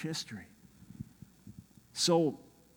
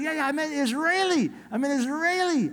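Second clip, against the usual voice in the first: high, loud, eager correction; imitated excited translator; 11 semitones higher, 5 dB louder than usual.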